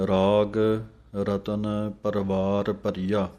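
ਰਾਗ ringing out, rest on ਪਰੀਆ; pause after ਪਰੀਆ. Shown as a demonstration. Teacher showing where to rest in ਰਾਗ ਰਤਨ ਪਰਵਾਰ ਪਰੀਆ. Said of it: "ਇੱਥੇ ਵਿਸਰਾਮ ਹੈ".

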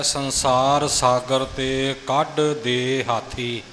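slow half-sung line with ਹਾਥੀ plain, no nasal; ਹਾਥੀ said haathi with no nasal sound; correct ਹਾਥੀ demo. Shown as a demonstration. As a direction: chant it slowly, ਹਾਥੀ without bindi. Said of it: "ਇਹ ਜੋ ਪਾਠ ਹੈ".

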